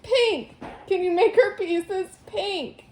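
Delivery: PINK pitched high and drawn out; emphatic correction. A narrator's reading. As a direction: say "pink" slowly and pointedly for a poor listener. Mom correcting the misheard word.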